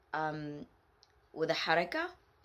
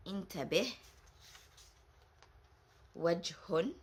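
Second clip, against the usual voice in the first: usual speaking voice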